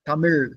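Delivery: Tamil with the tongue at the roof of the mouth; retroflex final sound. Shown as a demonstration. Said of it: "The l is from the tongue at the top of the roof of the mouth".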